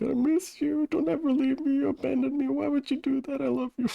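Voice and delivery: little pet begging not to be left; squeaky creature voice. Acted. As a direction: plead like a tiny needy animal. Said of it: "It's like".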